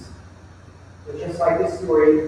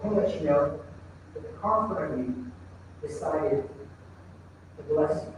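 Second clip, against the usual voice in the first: soft retold barista; 4 semitones lower, 8 dB quieter than usual. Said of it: "Someone else says".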